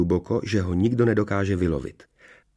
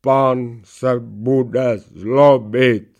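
very slow, loud, higher drawn-out voice; protesting knight's head; 5 semitones higher, 7 dB louder, much slower than usual. A narrator's reading. Tricked knight's head warns the princess, drawn-out protest. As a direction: protest helplessly, stretching every word. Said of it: "marně protestovala hlava oklamaného rytíře".

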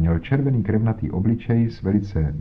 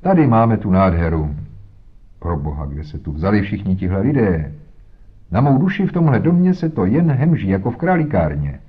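blustering police inspector; 4 dB louder than usual. Acted. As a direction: play the pompous inspector crashing the scene. Blustering inspector Jones arrives. (hlučný nafoukaný inspektor)

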